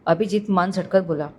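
usual speaking voice

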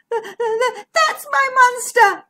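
high, loud, drawn-out squeal; crying out. A narrator's reading. Zara recognises the creature at last, crying out. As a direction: cry it out slowly in alarm. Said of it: "Zara cried".

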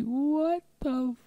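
high, slow, baffled outcry; stunned what-is-this. Retold or imitated. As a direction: act out your confusion, high and slow. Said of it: "I was like".

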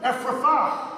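Jesus. Retold or imitated. Jesus speaking to the deaf man, commanding. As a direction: pronounce it as a command, pitched high. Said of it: "he said to the deaf man".

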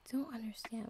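hushed frightened whisper. Acted. Patient whispering alone in his cell. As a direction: breathe it out, scared and lost.

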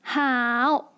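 drawn-out 好 with a dip in the middle; third tone. Shown as a demonstration. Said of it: "Third tone".

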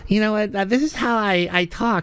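lightly voiced impression of the caller; mocking caller's voice. Host mimicking a caller to ridicule his voice. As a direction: play the dopey caller, a hint of mockery. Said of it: "you got my heart beating like a rabbit with that voice".

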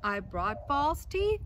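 calling out. Acted. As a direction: call it out at the door. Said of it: "the dentist called".

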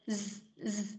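a long buzzing "Z" voiced in the throat. Shown as a demonstration. Teacher to student, showing the voiced z sound, softly. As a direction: hold "Z" with the throat vibrating. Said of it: "Đẩy hơi ra nhưng mà rung ở cổ".

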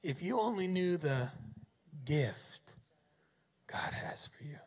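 gentle, dropping to a hush; fading to a whisper. Shown as a demonstration. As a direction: trail off into a whisper. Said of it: "Let's read what Jesus just said here again".

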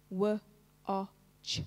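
"W, o, ch" as three separate speech sounds, wuh, short o, ch; sounded out. Shown as a demonstration. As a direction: say "W, o, ch" sound by sound with gaps, not letter names. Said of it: "Show me the sounds".

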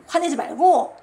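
loud burst of mock admonishing; emphatic scolding. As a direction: scold the kids with a sudden loud push. Teacher mimics admonishing angry students. (힘주어 크게 꾸짖듯)

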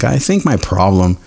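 ordinary talk

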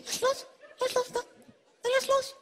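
high-pitched "let go" like to a puppy; high coaxing dog command. Acted. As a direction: coax it like training a dog. Teasing as if training dog.

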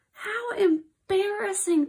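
high, slow wail of embarrassment; mock-mortified whine; embarrassed. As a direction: draw it out, cringing. Reader embarrassed after singing.

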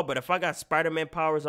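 ordinary talk

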